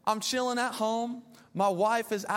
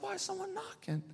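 puzzled mutter; 10 semitones higher, 10 dB quieter than usual. Retold or imitated. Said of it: "So I was like".

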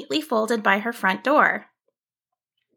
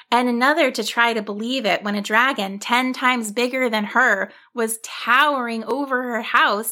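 ordinary delivery

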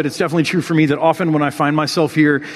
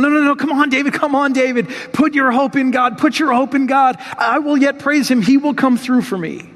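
raised, pleading self-encouragement; David crying out; 8 semitones higher than usual. Retold or imitated. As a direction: urge yourself on, high and pleading. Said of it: "he's crying out, he's going".

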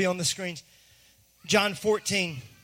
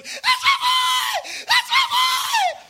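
very high, drawn-out ecstatic scream; full-out high-pitched cheering scream; screaming proud dad; 4 dB louder, much slower than usual. Retold or imitated. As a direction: imitate a dad screaming wildly for his kid. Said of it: "Just screaming".

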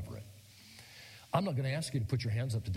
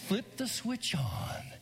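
dramatic whisper; 7 semitones higher, much slower than usual. Acted. Lights coming on for son.

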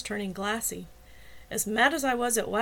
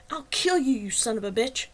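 shouted threat; 3 semitones higher, 4 dB louder than usual. A narrator's reading. Man yanking his rival off the couch, shouting threats. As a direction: hurl the threat at him, loudest up front.

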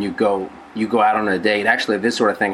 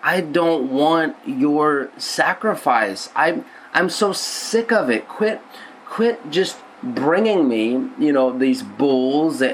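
higher, slow voice of a disgusted God; exasperated divine complaint, pitched up and drawn out; God fed up; 4 semitones higher than usual. Acted. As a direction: play God sick of empty sacrifices. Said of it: "Here he is in Psalm fifty saying".

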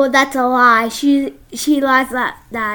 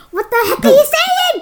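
high-pitched mock outburst; squeaky imitation of an annoyed listener; retold exasperation; 13 semitones higher, 3 dB louder than usual. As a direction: imitate someone baffled and worked up, pitch way up. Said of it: "It gets her like".

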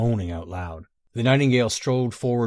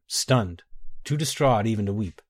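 usual speaking voice

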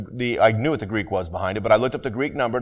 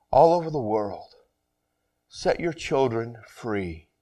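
slow, hushed pastoral prayer; 3 dB quieter than usual. Acted. Pastor praying over his church, quiet and earnest. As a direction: pray softly over the people.

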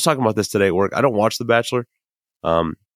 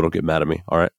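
normal talking voice

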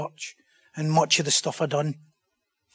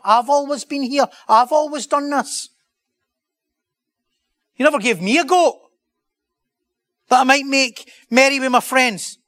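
much higher and louder, indignant complaint; angry older brother; 9 semitones higher, 8 dB louder than usual. Acted. Older brother complaining to his father, high indignant shout. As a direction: pile up each grievance, voice climbing high. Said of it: "But he was angry".

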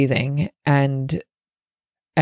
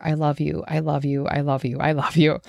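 soothing self-repetition; 2 semitones lower, 3 dB quieter than usual. Retold or imitated. Woman repeating love to herself during the biopsy, soothing murmur. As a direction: repeat it gently and evenly, like self-comfort. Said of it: "kept repeating to myself over and over".

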